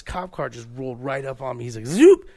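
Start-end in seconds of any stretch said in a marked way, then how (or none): 1.9-2.3 high loud siren whoop; a quick imitated police siren; mock siren whoop. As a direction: blurt a cop siren noise. Cop car rolling up.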